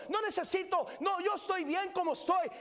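fiery revival preaching; preaching at full force. Acted. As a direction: preach it to the back row. Preacher's climax, shouted.

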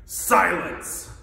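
loud, sharp royal command; barked. Acted. Turtle king cuts off Mac's complaint, barking. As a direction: bark it down at an underling. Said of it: "the king of the turtles barked down".

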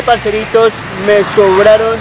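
ordinary talk

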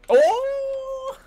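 high startled oh; surprised yelp. Real, not acted. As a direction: jump at the sight. Sudden yelp at an enemy.

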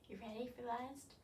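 ordinary talk, nothing marked

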